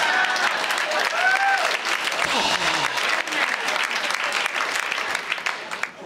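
long weary sigh. Acted. Weary sigh at wasted choices.

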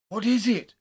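high, alarmed shout; loud cry. A narrator's reading. Friend answering Haldane's scream, crying out loudly. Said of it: "I cried, hardly less loud".

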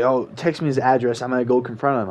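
ordinary talk, nothing marked

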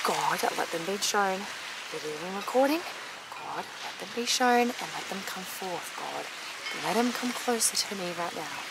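soft murmured prayer to God; hushed prayer. Real, not acted. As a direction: pray under your breath for the dolphins. Prays for dolphins to appear.

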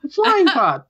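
very high-pitched little squirrel. Acted. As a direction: squeak it out like a welcoming squirrel. Game master voices the squirrel.

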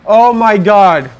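high, loud, frantic pleading; desperate shouts. Real, not acted. Gamer frantically begs for the save point. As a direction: scream for the save.

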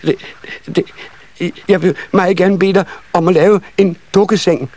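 put-on high child voice with stammered start; hesitant eager child. A narrator's reading. Second child asking for doll's bed, high halting voice. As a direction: stumble into the request, high and little.

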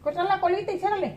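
firm mom, no nonsense; stern scolding. Real, not acted. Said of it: "si no me lo pongo seria".

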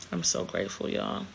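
breathy, near-tearless whisper, voice catching; overcome, barely getting the words out; choked up. Real, not acted. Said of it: "oh my God, I'm getting emotional".

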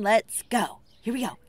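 usual speaking voice

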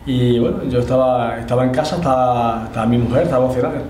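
ordinary talk, nothing marked

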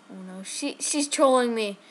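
normal talking voice

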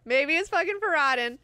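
emphatic realization. Acted. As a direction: hit it hard as it dawns on you. Emphatic repeat of the thought.

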